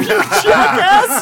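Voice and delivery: very high, loud, drawn-out jab; playful, cracked-up yell; laughing insult. Real, not acted. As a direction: shout the insult through laughter.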